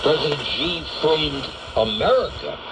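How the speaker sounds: ordinary talk, nothing marked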